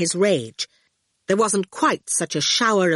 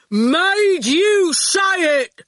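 very slow, high, loud crowing; triumphant shout; 11 semitones higher, 6 dB louder, much slower than usual. A narrator's reading. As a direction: stretch every word in triumph. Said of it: "Erskine shouted".

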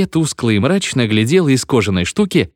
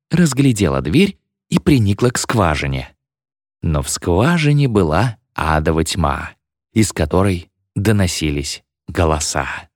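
ordinary delivery, nothing marked

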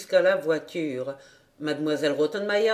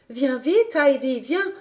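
put-on higher, eager girl calling out; Clara calling; 5 semitones higher than usual. A narrator's reading. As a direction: call her friend over excitedly. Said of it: "lui cria Clara". (Clara appelle, voix aiguë et joyeuse)

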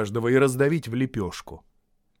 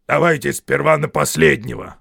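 lightly voiced loud troll; Берт; 3 semitones higher, 9 dB louder than usual. A narrator's reading. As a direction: answer loudly as a troll. Troll Bert answers.

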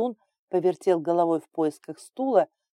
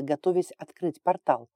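usual speaking voice

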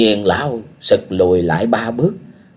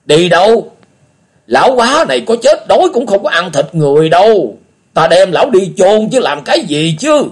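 put-on high, loud, irritated old man; old beggar offended by the question; snapping old beggar; 9 semitones higher, 5 dB louder than usual. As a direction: snap back indignantly. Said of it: "Lão Cái gắt lên".

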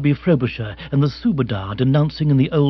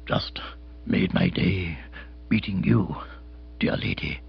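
low, hushed, husky with feeling; hoarse and moved; 8 semitones lower, 7 dB quieter than usual. A narrator's reading. Captain Biggar to the lady, hushed and hoarse. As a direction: choke it out softly, deeply touched. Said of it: "speaking hoarsely, for he was deeply moved".

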